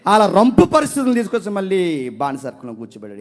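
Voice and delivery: loud, high, ringing sermon delivery; fiery preacher calling out; full-force preaching. Acted. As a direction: drive it home to the congregation. Preacher listing obedient biblical figures.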